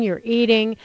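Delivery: normal talking voice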